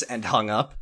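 ordinary delivery

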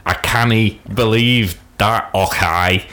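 slow, hammed-up Scottish accent like a TV character; mock Scottish accent. Mocking a TV show's Scottish accent, slow hammed-up Scottish. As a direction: imitate a bad screen Scottish accent, slowly. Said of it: "Scottish people don't really talk like that".

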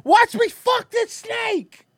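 high, drawn-out silly yell; slow high-pitched goofball voice; high mock reveler. Joking over the snake clip, high silly yell.

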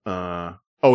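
ordinary talk

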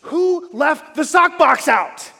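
loud, high, slow furious shout at the family; angry yell. Retold or imitated. Pastor yelling at his family in the car, angry shout. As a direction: bellow it out from the driver's seat. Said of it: "I put my hands up, it's like".

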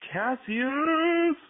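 real tipsy exclamation. Real, not acted. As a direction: blurt the name out tipsily. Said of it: "I'm fucking drunk".

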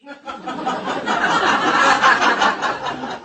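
long amused laugh up high, falling away. Real, not acted. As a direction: laugh at yourself for missing the obvious. Laughing at doctor's free meetings.

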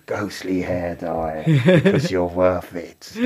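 slow, hammed-up ghostly ad read; spooky parody voiceover; mock spooky advert. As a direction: draw out the slogan like a creepy commercial. Parody of hair dye advert.

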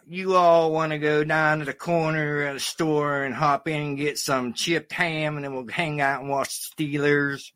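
put-on comic Pittsburgh accent; Yinzer accent. Comic showing off his practised Yinzer accent to the host. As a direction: imitate a Pittsburgh local in Yinzer dialect. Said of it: "Yinzer accent".

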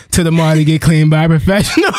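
reading as laughter takes over; breaking into laughter. Real, not acted. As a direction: hold it together, then crack at the end. Reading funny post, breaking up.

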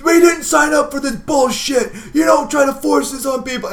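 high, slow furious yell at the police; enraged dad yelling from inside; screaming father. Acted. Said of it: "your father scream".

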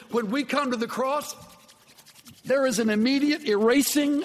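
preacher rising to a climax about God's cleansing; full-force preaching. Acted. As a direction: build it up and lift it to the rafters.

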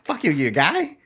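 high, loud mock South African insult; hammed-up South African accent, rising at the end. Host copying the co-host's short-fuse impression, mock South African accent. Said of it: "in a South African accent".

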